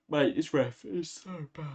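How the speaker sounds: yawning while speaking; words stretched by a yawn. Real, not acted. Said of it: "I'm sorry about the yawning".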